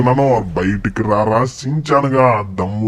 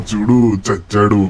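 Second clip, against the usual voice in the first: usual speaking voice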